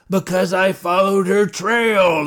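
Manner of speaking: a loud, gruff growl, voice set apart. A narrator's reading. Marshal growling back at Ozzy's question, loud and gruff. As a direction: bite out the words like a growl. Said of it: "the marshal growled".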